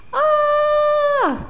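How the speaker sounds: high drawn-out ah; high calling ah; loud ah. Shown as a demonstration. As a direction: cry out ah like an angel appearing. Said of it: "can you say that really loud".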